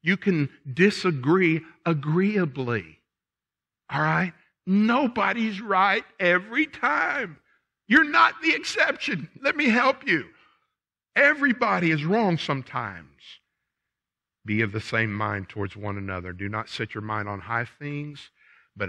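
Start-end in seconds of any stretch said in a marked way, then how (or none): 4.7-13.1 higher and louder, driving it home; preaching with force. Real, not acted. Preacher urges the congregation to admit being wrong, forceful. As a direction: preach it to the back pew.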